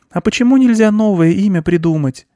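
put-on high child's voice; questioning girl. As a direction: ask like a little girl, pitched high. Child character asks farmer.

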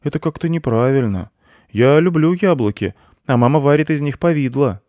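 put-on high, loud child's complaint; protesting boy. A narrator's reading. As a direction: plead the case as a small boy. Boy pleads for his apples. (высокий обиженный детский голос)

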